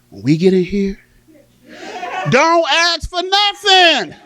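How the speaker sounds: high, loud, drawn-out scolding order; stern warning. Acted. Grandmother to kids before going into Kmart, stern warning. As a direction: lay down the law, slow and loud.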